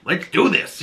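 mock pumped-up mom ready to help; excited. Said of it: "she's all excited".

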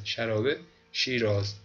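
ordinary talk, nothing marked

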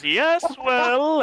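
high, loud smug villain sneer; mustache twirling villain. Acted. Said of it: "He twirls his mustache around his finger a little bit and goes".

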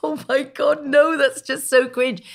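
drawn-out mortified groan; cringing. Retold or imitated. Wife recalling her embarrassment, drawn out and cringing. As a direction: squirm as you say it, slowly. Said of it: "And I'm thinking".

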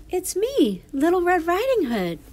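put-on high, loud little-girl voice. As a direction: call out brightly as a storybook child.

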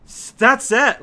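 sudden loud frustrated cry; exasperated shout. Real, not acted. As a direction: blurt it out in exasperation. Frustrated outburst at game.

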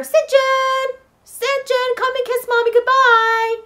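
loud, high, drawn-out motherly call; mom calling out. Acted. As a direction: call the child from another room, high and loud. Mom calling her child from away.

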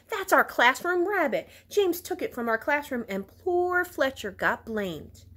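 mild high-pitched accusation; protesting schoolgirl, moderately worked up; indignant shout. A narrator's reading. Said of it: "shouted Gwen".

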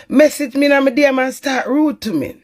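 ordinary delivery

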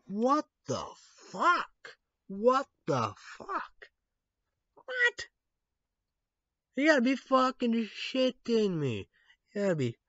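incredulous cry at the story; stunned outrage; shocked disbelief. Real, not acted.

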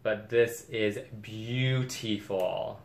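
very slow, savoring each word; drawn-out admiration. Real, not acted. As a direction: stretch it out, gushing over a treasure. Gushing over the bag.

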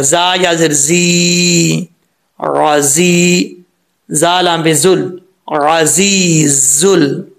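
जी held long with a buzzing, whistling z; whistled zaa. Shown as a demonstration. Teacher spelling the word for students, slow whistled z. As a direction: spell out राजीजुल slowly, throaty ayn and sharp z. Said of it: "सीटी के साथ पढ़ा जाएगा".

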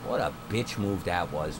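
usual speaking voice

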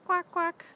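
duck quack. Shown as a demonstration. Imitating duck for toddler.

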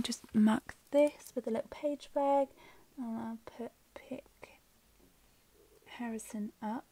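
soft whisper to herself. Real, not acted. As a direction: whisper while writing. Whispering while writing in planner.

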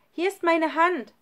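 high, loud call from the roof; von oben gerufen. A narrator's reading. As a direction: call down, offering help. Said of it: "rief Schlunz von oben". (laut von oben herabgerufen)

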